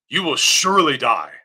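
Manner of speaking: ordinary talk, nothing marked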